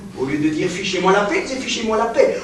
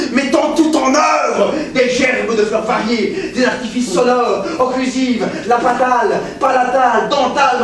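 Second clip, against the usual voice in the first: grand shout. Acted. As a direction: declaim it like a storm breaking. Professor carried away by lecture.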